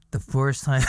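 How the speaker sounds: barely gets the words out for laughing. Real, not acted. Telling his friend the story, cracking up laughing.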